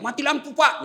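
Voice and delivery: high-pitched mock yell; calling out. Locked-in prisoner to the guard as lights fail, calling out. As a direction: cry out for the guard. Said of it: "paling masih bisa teriak".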